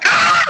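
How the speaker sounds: short, high, loud yelp of pain; mock pain scream. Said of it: "Like, I screamed".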